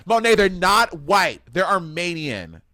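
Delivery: emphatic correction. Retold or imitated. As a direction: put them straight, each word drawn out. Correcting, acted indignant objection.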